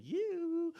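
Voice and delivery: high, drawn-out "yoo" whoop; high-pitched celebratory holler; party whoop. Retold or imitated. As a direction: whoop like you're dancing at a party. Whooping while telling of dancing.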